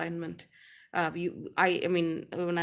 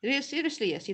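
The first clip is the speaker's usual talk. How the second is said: usual speaking voice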